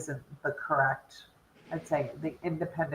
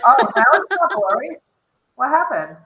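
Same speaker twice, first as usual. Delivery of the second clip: higher, louder surprised exclaim; 6 semitones higher, 7 dB louder than usual. Real, not acted. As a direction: react with sudden surprise. Screen suddenly clear.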